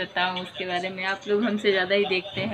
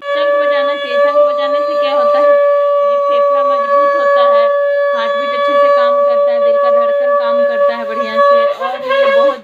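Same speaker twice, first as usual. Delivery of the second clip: much louder, emphatic lecture; loud preachy advice; 11 dB louder than usual. Real, not acted. Mother loudly preaching about conch blowing, emphatic. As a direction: proclaim it to the whole house.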